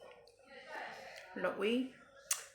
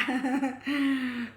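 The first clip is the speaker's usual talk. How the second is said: high, breathy giggle fading out; giggling laugh; 6 semitones higher, 5 dB louder than usual. Real, not acted. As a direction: let a giggle spill out. Laughing after playful bites.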